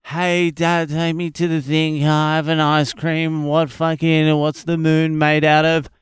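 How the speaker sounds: put-on whiny kid voice. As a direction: nag like a little kid wanting things.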